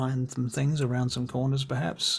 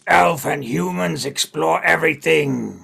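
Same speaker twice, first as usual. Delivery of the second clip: accusing goblin; 3 semitones higher, 9 dB louder than usual. Acted. Goblin accuses elves and humans, loud and aggrieved. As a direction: accuse them in a big goblin voice.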